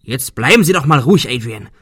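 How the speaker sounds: loud, cross telling-off; scolding. Acted. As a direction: snap at him to calm down. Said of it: "schimpfte Arthur".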